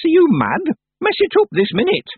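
put-on high, loud, indignant old witch; demanding. A narrator's reading. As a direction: snap out the command sharply. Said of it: "Miss Treason demanded".

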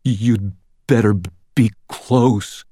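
teeth-chattering, broken delivery; shivering. A narrator's reading. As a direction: force the words out through hard shivers. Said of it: "shivering so hard I could barely stand".

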